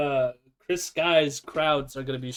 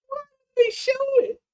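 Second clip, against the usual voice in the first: excited; 10 semitones higher, 4 dB louder than usual. Real, not acted. Excited reaction to arrest clip.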